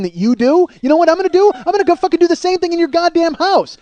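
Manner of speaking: loud, high-pitched indignant yelling; furious tirade. Acted. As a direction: tear into them in outraged disbelief. Imagined furious tirade at hostess.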